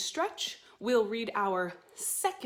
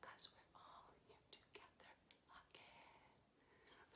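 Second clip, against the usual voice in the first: soft whispered echo of the line; 33 dB quieter than usual. Shown as a demonstration. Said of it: "like an echo".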